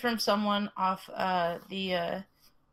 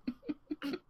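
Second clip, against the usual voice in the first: high laugh; 7 semitones higher, 9 dB quieter than usual. Real, not acted. Laugh after own joke.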